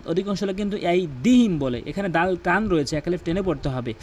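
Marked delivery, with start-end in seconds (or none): none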